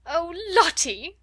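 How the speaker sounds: high, loud, slow cry of the name; teacher screaming at a crying child; flustered scream. A narrator's reading. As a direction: shriek her name in a panic. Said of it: "screamed Miss Amelia".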